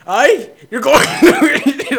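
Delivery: high, loud, slow hammed-up Irish brogue, laughing; badly faked Irish accent, cracking up mid-line; laughing mock Irish brogue. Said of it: "with Irish accents".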